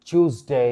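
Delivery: "টিউসডে" as "chooz-day"; accepted variant. Shown as a demonstration. Teacher approving another form, "টিউসডে" pronounced "chooz-day". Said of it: "এটাও সঠিক".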